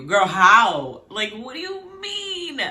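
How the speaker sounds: incredulous, drawn out and pitched high; exasperated disbelief. Acted. Host challenges the show's heroine, in exasperated disbelief. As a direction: stretch it into a high, baffled protest. Acted outrage at a character's claim.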